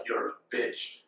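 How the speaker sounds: low, soft, mostly whispered insult; Mewtwo's put-down. Retold or imitated. Mewtwo to his trainer, quiet put-down. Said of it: "and then he's like".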